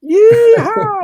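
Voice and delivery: high, loud American cowboy whoop with a laugh; American yeehaw. Retold or imitated. Playing the American stockbroker for laughs, loud American yeehaw. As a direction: whoop it like a hammed-up Hollywood cowboy in an American accent. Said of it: "what part of America that accent's from".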